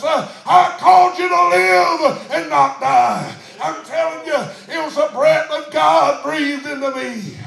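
loud revival preaching, building to a shout; full-force preaching. Real, not acted. Preacher relaying God's call to thrive and not die, full-force preaching. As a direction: hammer each phrase out to the congregation. Climax of the sermon.

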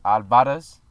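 Alvarez stressed on the middle syllable, al-VA-rez. Shown as a demonstration. Showing a wrong option for his surname, al-VA-rez. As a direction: move the weight to VA in Alvarez. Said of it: "'Cause you could say Alvarez".

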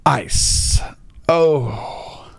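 gasp, then whisper the name; hushed shocked whisper; teasing the audience with a gasped whisper. Pretend. Gasping whisper reveal.

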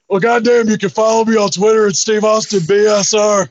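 hammed-up Stone Cold phone promo; Steve Austin impression. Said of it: "I did my Steve Austin impression".

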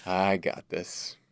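slow, cool, unbothered; a pilot fully in control; calm confident voice. Retold or imitated. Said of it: "He says".

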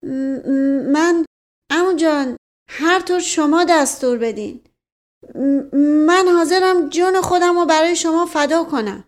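put-on frightened boy stuttering out every word; stammering in fear. A narrator's reading. Scared nephew stammering promises to his commanding uncle. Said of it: "با کلمات بریده فشفش کنان گفت".

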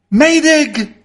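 high, loud, panicked cry into a storm; shrill cry of a man in terror; desperate scream. Acted. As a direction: scream for help over the gale. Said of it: "screamed Mister Fotheringay's feebled voice".

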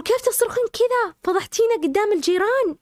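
angry brother. Retold or imitated. Brother scolding after beating.

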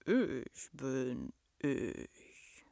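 low, very slow beast voice; rumbling monster. A narrator's reading. Monster answers the girl's question, deep and very slow. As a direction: drawl it simply from deep down.

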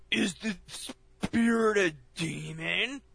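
slow, high, dramatic ghost-hunter voice; Zak Bagans impression. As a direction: ham up an overdramatic TV investigator. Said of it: "here he comes in like".

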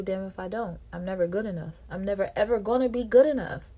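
usual speaking voice

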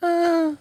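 high mock grunt; hammed-up sex noise. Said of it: "Demonstrate with a dramatic interpretation of your signature sex sounds".

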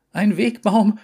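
plötzlich hoch und laut gerufen; jubelnder Ausruf. Acted. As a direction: ruf es freudig hinaus. Entdeckung, wiederholt lauter. (jubelnd laut gerufen)

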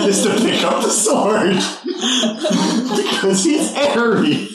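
laughing through the words; cracking up mid sentence. Real, not acted. Laughing about Eri's reckless choice.

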